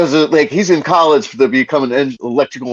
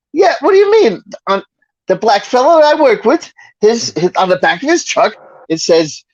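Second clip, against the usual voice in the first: high Archie Bunker explaining himself; pleased old bigot story, pitched up; 9 semitones higher than usual. Retold or imitated. Archie telling about his coworker's truck, high smug. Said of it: "he's like".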